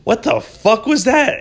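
high, slow mock outrage; stunned he turned it down; a hammed-up what the hell; outraged disbelief. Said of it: "I'm like".

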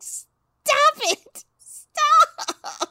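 very high, loud pleading scream; mock shriek. Shouting at the character calling it the glowworm, high shriek. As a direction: shriek at the TV to stop. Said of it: "I'm like".

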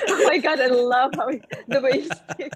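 giggling while praising; delighted laughter spilling into speech; laughing delight. Real, not acted. Delighted by accent joke.

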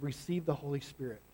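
a breath of a blessing, almost a whisper; soft hushed Jesus. Acted. Jesus breathing on his disciples, speaking soft and hushed.

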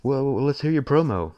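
hyped-up morning show host; big, loud, high radio announcer. Retold or imitated. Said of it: "they're like".

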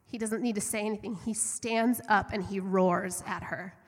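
ordinary talk, nothing marked

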